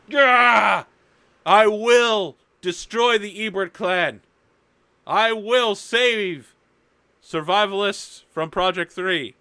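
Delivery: high, loud, drawn-out heroic shout; roaring battle oath. Acted. Hero swearing on his sword to destroy the clan, roared vow. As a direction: bellow the vow slowly, stretching the words.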